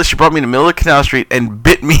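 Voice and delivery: usual speaking voice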